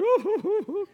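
high, loud hooting sob imitation; mock sobbing. Mocking tender men who sob at the name of Jesus. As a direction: mimic guys breaking down crying at his name. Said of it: "and they're like".

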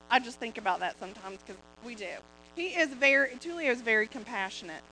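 ordinary talk

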